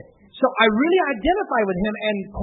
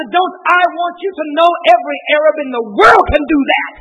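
loud, high shouted preaching; full-force preaching; 6 semitones higher, 10 dB louder, slower than usual. Real, not acted. Preacher roaring the hymn's message at his congregation. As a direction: preach it hard to the crowd.

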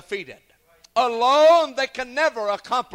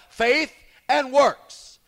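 ordinary talk, nothing marked

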